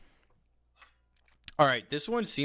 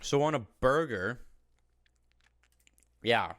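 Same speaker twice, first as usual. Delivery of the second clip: talking while chewing chicken; words around a mouthful of chicken; eating crunchy fried chicken. Real, not acted. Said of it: "And I'm eating".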